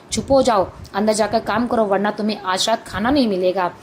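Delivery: ordinary delivery, nothing marked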